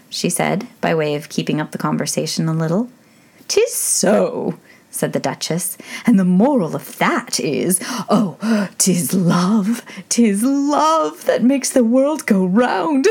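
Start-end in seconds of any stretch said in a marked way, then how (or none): 3.5-13.1 lightly voiced old duchess; a hint of a fussy old duchess; frail withering duchess. A narrator's reading.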